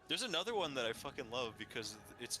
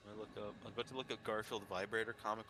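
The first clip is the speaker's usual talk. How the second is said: normal talking voice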